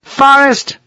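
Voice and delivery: high, commanding yell; calling out. A narrator's reading. Colonel summoning his major, calling out. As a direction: summon him with a high-pitched call.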